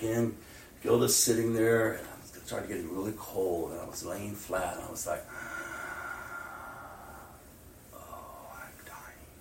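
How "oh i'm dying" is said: weak, whispered dying words; reliving collapse, faint whisper. Retold or imitated. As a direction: breathe it out as if fading. Retelling fainting on hospital bed.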